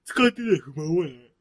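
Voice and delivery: words through a yawn. Real, not acted. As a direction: yawn while talking. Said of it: "あくびしながら言わんといてくれる？".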